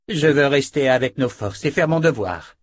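determined soldier. Acted. Rika answers.

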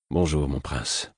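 soft low murmured bonjour; low, slow greeting. A narrator's reading. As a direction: greet him quietly and slowly. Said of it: "dis-je à mon tour". (bonjour lent, grave et doux)

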